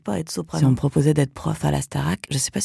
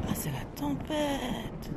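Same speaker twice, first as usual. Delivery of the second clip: chuchoté; 7 semitones higher, 13 dB quieter, much slower than usual. Pretend. Chuchote, panique intérieure.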